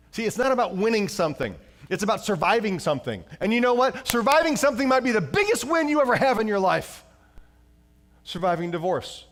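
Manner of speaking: high, loud building preaching; preaching at full force. Acted. Pastor preaching the climax, high and loud. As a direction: drive it home to the congregation.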